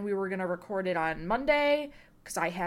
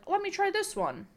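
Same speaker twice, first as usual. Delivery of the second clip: eager try; 11 semitones higher than usual. Retold or imitated. Retelling eager attempts at machines.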